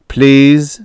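an understated plea; pleading. A narrator's reading. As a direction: plead gently but clearly. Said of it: "delicate sense of pleading to the word".

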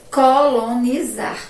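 "Colonizar" stretched syllable by syllable; slow dictation. Shown as a demonstration. Teacher repeating a word for the class, slow dictation. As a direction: say "Colonizar" slowly for spelling.

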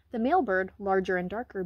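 usual speaking voice

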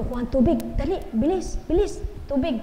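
urgent retold order. Retells urgently ordering water.

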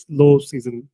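usual speaking voice

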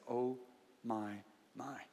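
hushed awe with pauses between words; retold humbled realization. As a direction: act out a pastor quietly stunned. Pastor humbled on seeing the scripture.

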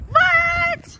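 high startled "what?"; sudden loud disbelief; surprised. Real, not acted. Surprise at a duplicate.

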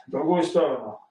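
ordinary talk, nothing marked

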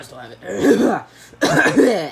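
distorted voice changer effect; slow, warped by a voice changer. Real, not acted. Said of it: "I had my voice change".

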